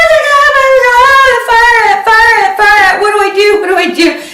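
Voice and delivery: high, loud, sing-song panic; panicked freak-out. Retold or imitated. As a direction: squeal it in a frantic high panic. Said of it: "I'm freaking out".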